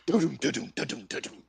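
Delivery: pulsing doo-doom sting, fading away; mock suspense music. Mocking the American show's soundtrack, obnoxious suspense pulse. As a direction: imitate an obnoxious TV score. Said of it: "all this obnoxious ass fucking".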